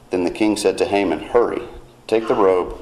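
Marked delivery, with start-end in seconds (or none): none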